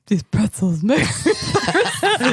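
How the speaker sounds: slow, laughing put-on man's voice; slow mock catchphrase with a giggle. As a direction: play a man, barely holding in laughter. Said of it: "doing the man voice".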